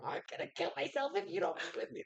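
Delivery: high whiny mock teenager; mock a clingy teen. Mocking the lovesick boy.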